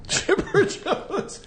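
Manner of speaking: high amused laugh through the name. Real, not acted. Laughing repeat of guess.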